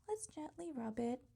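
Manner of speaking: normal talking voice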